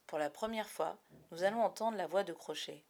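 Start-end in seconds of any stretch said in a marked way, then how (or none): none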